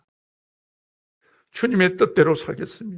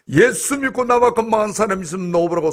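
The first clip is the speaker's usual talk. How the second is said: a retold cry flung skyward, louder and higher; retold shout; 6 semitones higher, 5 dB louder than usual. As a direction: shout it at the heavens in the retelling. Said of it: "소리 질렀습니다".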